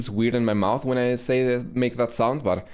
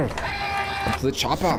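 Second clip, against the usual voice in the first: Schwarzenegger impression; much slower than usual. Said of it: "thick like Schwarzenegger in Terminator".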